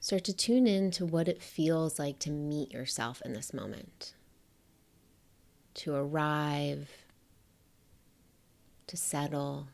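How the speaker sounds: soft, unhurried meditation voice with long pauses; hushed, spacious guidance, letting each phrase rest; guided meditation. Acted. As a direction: gently guide the listener to settle in.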